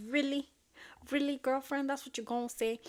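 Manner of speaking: acted high, loud disbelief; sassy, affronted daughter; incredulous retort. Daughter's affronted reply to mom.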